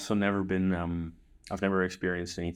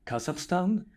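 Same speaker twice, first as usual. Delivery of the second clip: higher-pitched, questioning mock voice; imitated local man; 11 semitones higher, slower than usual. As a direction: lightly imitate a puzzled foreigner's question. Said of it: "he could barely speak English".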